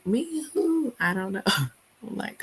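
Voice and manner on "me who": high, baffled grandma. Retold or imitated. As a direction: lightly mimic the confused grandmother. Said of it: "And now it's like".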